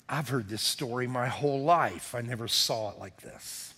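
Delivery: slow, soft prayer voice. Acted. Preacher praying to God for the congregation, slow and soft. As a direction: pray quietly to the Father.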